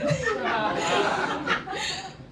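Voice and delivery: can't hold back a giggle; real high giggle. Real, not acted. Reader cracks up at line.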